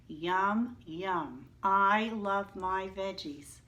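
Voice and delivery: ordinary talk, nothing marked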